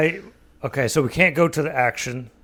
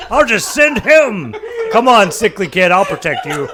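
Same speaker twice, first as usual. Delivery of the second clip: laughing; 6 semitones higher, 5 dB louder than usual. Acted. Mock heroic to sickly kid.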